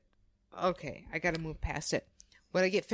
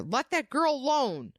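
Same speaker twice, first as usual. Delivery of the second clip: higher-pitched mock shout at an attacker; retold shout; 9 semitones higher than usual. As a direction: call it out like a neighbor, not at full volume. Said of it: "one of the neighbors shouts at the attacker".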